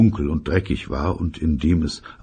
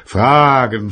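grumpy father; 8 semitones higher, 6 dB louder than usual. Acted. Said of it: "sagte sein Vater".

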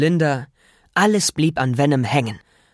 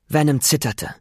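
plain reading voice

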